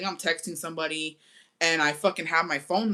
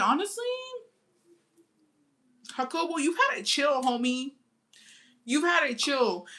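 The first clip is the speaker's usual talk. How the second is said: high, mock lecturing tone; half-teasing, telling him he's lucky; addressing Jacobo; 8 semitones higher than usual. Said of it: "I was like".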